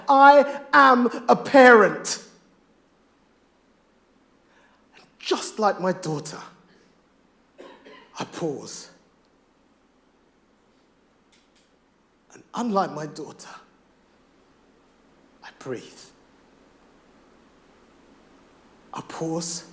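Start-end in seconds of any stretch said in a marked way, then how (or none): 0.1-2.2 slow, much louder defiant declaration; battle cry. Acted. Grieving father rallying other parents, a slow loud battle cry. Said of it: "here comes my battle cry". 5.0-15.8 rhythmic performed poem, swelling from hushed to loud; spoken word poem. Acted. Poet to the audience about his stillborn daughter, spoken word.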